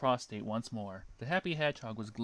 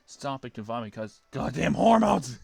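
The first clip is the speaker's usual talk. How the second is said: loud outburst; 3 semitones higher, 12 dB louder than usual. A narrator's reading. Narrator bursting out.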